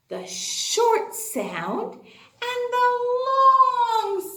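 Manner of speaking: "long" drawn out slowly and high; long stretched out. Shown as a demonstration. Teacher stretches "long" for her kindergartners. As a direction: stretch "long" to show its length.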